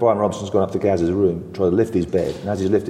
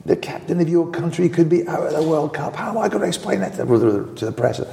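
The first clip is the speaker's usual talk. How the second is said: imitating Bobby Robson; 9 semitones higher than usual. Bobby Robson dressing down hungover players, high mock scolding. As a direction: mimic a stern boss tearing into his players. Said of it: "He went right through us".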